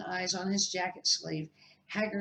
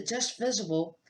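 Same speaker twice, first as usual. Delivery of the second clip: plain reading voice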